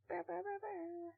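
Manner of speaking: soft unvoiced brr; whispered trill. Real, not acted. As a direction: coo quietly at the pet. Playful noise to pet.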